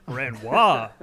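loud, grand pompous name; pompous name drop. Retold or imitated. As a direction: announce it grandly.

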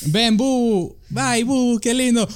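put-on high cooing baby talk. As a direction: coo it like talking to a baby. Cooing at brother's nickname Bu.

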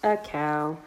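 ordinary delivery, nothing marked

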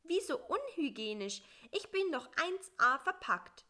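small, high and plaintive; a little fly grumbling high up. Acted. Bissi to the spider at night, high and plaintive.

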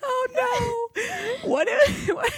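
very high, cry-laughing squeal; laughing hard. Real, not acted. Host cracking up at the fake accent. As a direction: lose it in helpless laughter.

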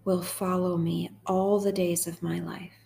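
slow, measured psalm reading with long pauses; guided meditation. Acted. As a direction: read it line by line, unhurried, leaving room to repeat.

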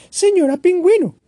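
put-on high, small childlike bird voice; a tiny chick asking hopefully; little bird Choco. Choco calls the penguin. (pajarito Choco, voz aguda e infantil)

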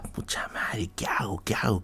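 whispered to himself, torn; hushed fretting whisper; panicked whisper. Retold or imitated. Deciding alone whether to go, whispering in panic.

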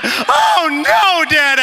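high, loud imitation of a wailing boy; hysterical child. Son freaking out to his dad, hysterical mock wail. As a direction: wail it like a kid losing it. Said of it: "I mean, he's losing it".

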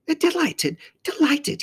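very high faun character voice; delighted faun. A narrator's reading. Faun welcoming his first human, high and delighted. Faun greets the girl warmly.

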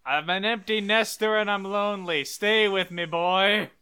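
mock-plaintive empty nester; clingy lonely mom.

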